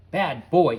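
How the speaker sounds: very loud scolding; shouted. A narrator's reading. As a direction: scold like to a puppy. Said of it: "he shouted".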